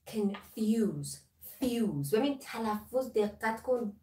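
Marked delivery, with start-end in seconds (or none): 0.1-2.1 "Confuse" with a short first syllable, long FUSE; slow teacher's "Confuse", stress landing on the f; stress on second syllable. Shown as a demonstration. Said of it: "روی ف تاکید داره".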